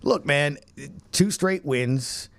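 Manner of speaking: ordinary talk, nothing marked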